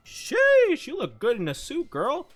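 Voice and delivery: high excited "sheesh"; impressed catcall. Retold or imitated. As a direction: hype up a friend's outfit. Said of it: "and it's like".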